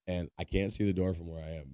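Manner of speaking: normal talking voice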